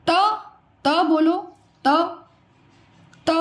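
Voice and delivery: त को जीभ दांत से छूकर बोलो; हल्का सा त, जीभ दांतों पर; dental ta demo. Shown as a demonstration. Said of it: "त बोला आपने". (दंत्य त साफ़ बोलना)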